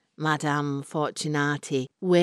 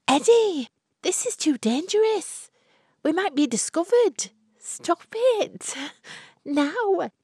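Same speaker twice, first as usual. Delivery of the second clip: much higher, urgent breathy protest; nervous young woman; 13 semitones higher than usual. Acted. Florrie warns her secret lover Eddie, high and urgent. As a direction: protest half-thrilled, half-afraid.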